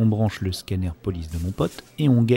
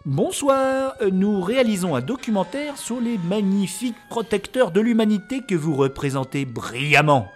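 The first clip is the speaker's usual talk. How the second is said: flattering interviewer; 10 semitones higher, 5 dB louder than usual. Real, not acted.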